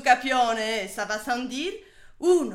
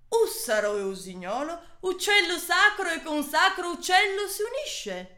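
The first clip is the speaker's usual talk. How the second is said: ordinary talk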